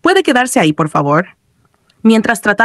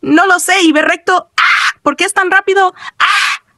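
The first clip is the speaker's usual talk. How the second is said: high-pitched mock panic; panicked cries; 11 semitones higher than usual. A narrator's reading. Player disoriented in the game, panicked cries. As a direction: cry out high, lost and flustered. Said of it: "gritó".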